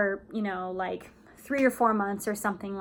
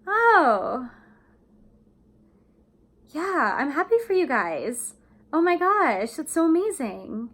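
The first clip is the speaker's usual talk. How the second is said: high, loud, fake cheer over a sob; forced happiness; 6 semitones higher, 4 dB louder, slower than usual. Shown as a demonstration. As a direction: pretend to be happy for them. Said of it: "the tone kinda goes down a little bit".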